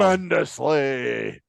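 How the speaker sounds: stretched out slowly; slow drawn-out word. Acted. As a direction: drag the word out.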